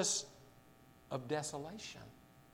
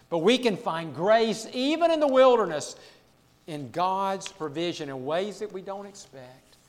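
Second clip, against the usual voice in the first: usual speaking voice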